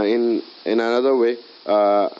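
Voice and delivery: ordinary talk, nothing marked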